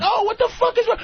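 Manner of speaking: high-pitched retold worry; imitated alarmed concern. Guest retelling his worry over a friend, mock concern. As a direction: act out being taken aback by a thin friend. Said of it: "You were even concerned".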